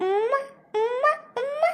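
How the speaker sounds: high, soft, drawn-out kissy hum; flirty moan. Acted. Cellmate teasing the woman she is lying on, cooing. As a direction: coo it like a clingy flirt. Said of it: "ಇವಳು ಮಾತೆತ್ತಂದ್ರೆ ಉಮ್ಮ".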